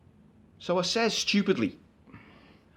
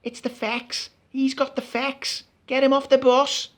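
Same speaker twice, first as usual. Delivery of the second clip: mock mob yelling; 6 semitones higher, 3 dB louder, slower than usual. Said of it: "a bellowing mob down me ear going".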